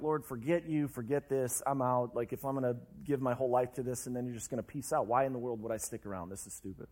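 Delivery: a hint of bitter quitting, trailing to almost nothing; quiet giving up. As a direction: let it sink away as if you're done. Ready to give up on God.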